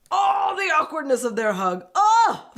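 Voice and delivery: loud, high cry building to a squeal; cringing cry. Real, not acted. Cringes at the awkward hug.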